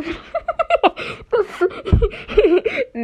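high, loud burst of laughter; real laughing fit. Real, not acted. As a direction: crack up at your own silly voice. Cracks up at own demo.

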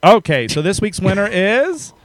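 slow, high drumroll reveal; hyped giveaway announcement. Acted. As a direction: build suspense, then name the winner. Announcing contest winner after spin.

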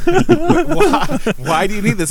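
high, puzzled squeak on why; playfully imitating a confused dad; mock baffled question. Imitating her boyfriend's dad asking why she needs beer, baffled high squeak.

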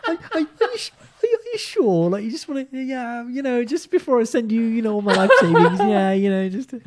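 very high, trembling, laughing plea; mock timid doubter. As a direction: stammer like a nervous client. Mimics timid doubting client.

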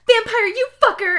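high, loud, hammed-up rage; theatrical angry shout; furious outburst. A narrator's reading. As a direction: yell it as an insult. Acted slap in story quarrel.

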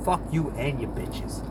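ordinary talk, nothing marked

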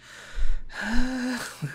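big, high put-on sigh; resigned sigh. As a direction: sigh loudly in mock surrender. Child giving in to dad's order.

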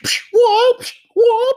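high, loud kung-fu yelps; karate shouts. Retold or imitated. Imitating the karate sounds on the album, high yelp. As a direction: imitate a karate film's sharp high cries. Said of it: "like karate shit".

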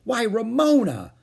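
high, loud scandalized exclamation; shocked. A narrator's reading. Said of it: "Beezus was shocked".

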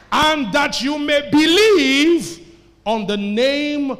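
loud, high, drawn-out preaching; emphatic declaiming. Real, not acted. Preacher proclaims scripture to the congregation, emphatic shout. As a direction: stretch the verse out at full force.

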